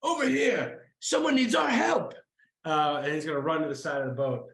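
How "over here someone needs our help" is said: high-pitched, urgent rallying call; Sir Richard. Acted. Game master as a character rallying the crew, high urgent call. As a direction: call everyone over, high and growing louder. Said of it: "Sir Richard is gonna say".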